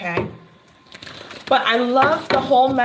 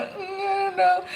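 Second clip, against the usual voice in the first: much higher, fast sing-song whimper; whiny scared kid; 9 semitones higher, faster than usual. Pretend. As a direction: whine with a finger in the mouth like a toddler. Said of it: "his finger in his mouth and going".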